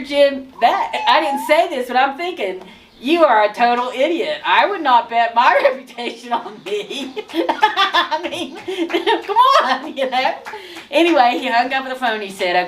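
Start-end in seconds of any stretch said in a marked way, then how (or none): 3.0-10.3 laughing through the words, pitched up; laughing disbelief. Retold or imitated. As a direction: giggle at how absurd it is. Her private thoughts about the pastor.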